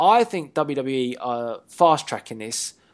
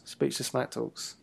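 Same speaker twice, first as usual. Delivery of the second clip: speechless smack talks muttered low and much softer; dry throwaway; 3 semitones lower, 11 dB quieter, slower than usual. Pretend. Cohost repeats the made-up title to himself, much softer and slower. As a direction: drop it quietly under your breath.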